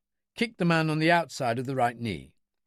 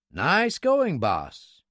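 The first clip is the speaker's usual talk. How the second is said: higher, admiring praise that drops on the last word; complimenting; 4 semitones higher, 3 dB louder, much slower than usual. A narrator's reading. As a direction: congratulate him with an upward lift, falling away. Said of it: "he complimented".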